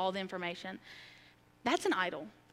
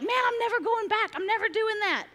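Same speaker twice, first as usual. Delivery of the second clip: much higher and louder vow; fierce resolve; 11 semitones higher, 3 dB louder than usual. Retold or imitated. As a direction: swear it like you mean it forever. Quoting her own past vow.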